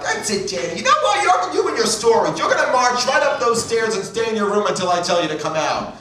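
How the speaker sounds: high-pitched comic telling-off; angry screaming mother. Retold or imitated. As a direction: imitate a mother blowing up at her child. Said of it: "getting her angry".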